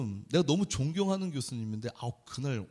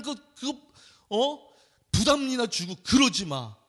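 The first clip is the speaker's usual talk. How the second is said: higher, louder lecturing tone; mock preachy professor; 6 semitones higher, 3 dB louder than usual. Professor lecturing the pastor again, pitched up and preachy. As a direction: hammer the message like a pushy lecturer. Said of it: "또 그 얘기를 하시는 거예요".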